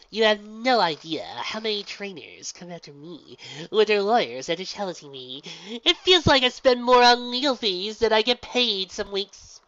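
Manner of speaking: loud, slow showman voice; showy gym leader. Acted. Gym leader explains her stage act to Pinky, loud and showy. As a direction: ham it up for the crowd.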